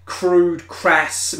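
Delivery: ordinary talk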